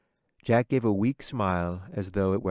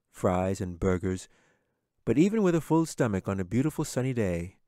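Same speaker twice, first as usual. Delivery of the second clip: ordinary delivery, nothing marked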